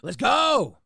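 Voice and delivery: high, loud whoop; exultant shout; excited cheer. Real, not acted. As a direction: yell it to hype everyone up. Cheers news of an album.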